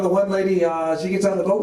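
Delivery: ordinary delivery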